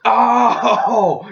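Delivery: high, loud comic "Oh"; hammy victim cry; mock pained gasp. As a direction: yelp like he's just been hit. Victim's reaction acted out.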